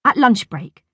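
ordinary delivery, nothing marked

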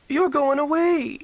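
put-on high, slow childish wail; a little sister crying that her brother is leaving. Little sister wails at her brother leaving home, high and slow. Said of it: "she wailed".

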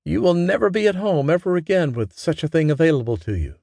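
mother teasing her daughter about the sewing machine. A narrator's reading. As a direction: tease her fondly. Mama continues teasing.

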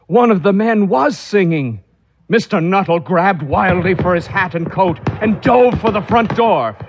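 high, urgent telling of his escape; frantic narration. A narrator's reading. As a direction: race through his flight in panic.

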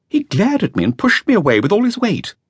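lightly voiced nervous young man; young man's voice. A narrator's reading. Flautist continues his account.

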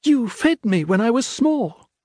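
put-on high, earnest young girl's voice; pleading daughter Faith. Faith pleads with her father.

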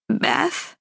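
high, questioning, tearful call; tearful mom. A narrator's reading. Mother on the phone to her daughter, tearful. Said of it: "sounds like she's been crying".

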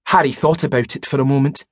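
ordinary delivery, nothing marked

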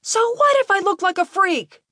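very high, angry shout back; defiant yell. A narrator's reading. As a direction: throw the insult back at your father. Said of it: "he yelled at his father".

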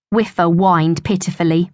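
ordinary delivery, nothing marked

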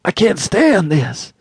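high, slow, desperate cry; hoarse cry. A narrator's reading. Father at wit's end to nurse, desperate drawn-out cry. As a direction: draw it out at the breaking point, high. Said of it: "he cried hoarsely".

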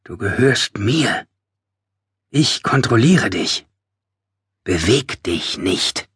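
slow, deliberate, word by word; controlling command. A narrator's reading. Narrator masters the Hollow, slow intense command. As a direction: take hold with slow, intense words.

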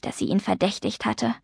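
ordinary delivery

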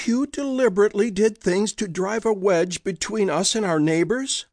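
high, shocked question; gasping disbelief. Acted. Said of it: "Josie gasped".

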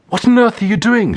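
high, sharp surprised outburst; a young woman shocked at a cake on the table; startled question. A narrator's reading. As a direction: blurt it out in disbelief.

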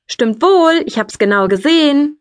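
high-pitched stubborn comeback; defiant insisting. A narrator's reading. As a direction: insist like a squabbling brother. Brother insists in sibling quarrel.

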